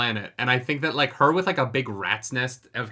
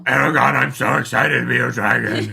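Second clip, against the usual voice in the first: hammed-up rocky dragon growl, louder; mock dragon voice gargling rocks; Saphira voice; 6 dB louder than usual. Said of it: "Can you try and recreate it?".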